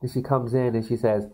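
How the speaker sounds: usual speaking voice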